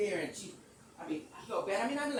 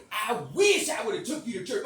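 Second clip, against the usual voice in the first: loud, high wish with force; retold exclamation; 6 semitones higher, 12 dB louder than usual. Speaker scolding Sweet about church, loud and emphatic. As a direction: belt out the regret at her.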